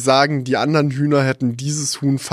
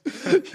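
high giggle; 12 semitones higher, 4 dB quieter than usual. Real, not acted. Laughs mid-impression.

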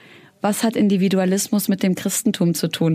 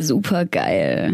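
low, slow [name] drawl; [name]; 6 semitones lower, much slower than usual. Retold or imitated. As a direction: drag out the word, deep and slow. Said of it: "dieser Typ von diesem [name]-Song".

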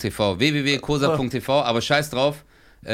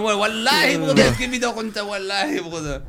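put-on higher preacher-style Arabic accent in German; a Pierre Vogel style Arabic accent in German; 8 semitones higher than usual. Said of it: "der moderiert das".

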